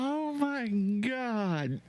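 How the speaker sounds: very high, slow, strained; drawn-out high-pitched horror; horrified disbelief. Real, not acted. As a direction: squeeze it out, utterly appalled. Reacting to texts.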